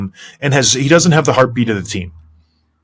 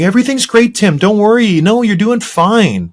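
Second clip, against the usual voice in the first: mock soothing coach; 8 semitones higher than usual. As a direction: coo like a coach who pats your back. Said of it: "patting you on the back and saying".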